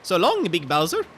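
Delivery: high, loud cartoon hero taunt; Mario. Streamer taunting a game villain, high loud mascot impression. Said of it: "Or Mario. Mario works too".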